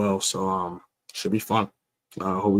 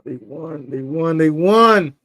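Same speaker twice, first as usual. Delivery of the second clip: repeated, higher and louder each time; building excitement; 6 semitones higher, 7 dB louder, slower than usual. Real, not acted. Fan hearing his team won, building to a shout. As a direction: climb from disbelief to a shout. Eagles win announced.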